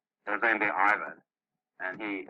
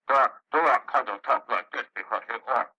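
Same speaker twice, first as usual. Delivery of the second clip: a hint of a higher orc shaman voice; lightly put-on orc growl, a bit higher; Durak's orc voice; 4 semitones higher, 3 dB louder than usual. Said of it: "Durak voice when he talks like this".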